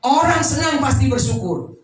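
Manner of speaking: emphatic sermon voice with punched openings; preaching. Real, not acted. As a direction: hammer out each contrast to the congregation. Preaches at full force. (khotbah tegas penuh penekanan)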